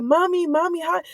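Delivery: a hint of a high little-girl call; child calling mommy. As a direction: lightly voice a child greeting mom.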